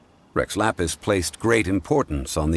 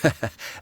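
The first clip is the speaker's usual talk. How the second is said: a brief amused chuckle; 3 semitones higher than usual. Acted. Zhongli chuckling after his quip about Mora. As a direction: chuckle at your own joke.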